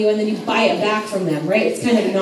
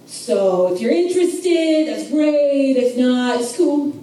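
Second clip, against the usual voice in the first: put-on New York accent; 2 semitones higher than usual. Boaz offering the land to the kinsman, in a New York accent. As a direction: pitch a deal like a put-on New Yorker. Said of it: "Stop doing a New York accent".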